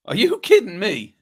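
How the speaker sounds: high, slow disbelief; incredulous. Real, not acted. Host stunned that co-host guessed the frog, incredulous.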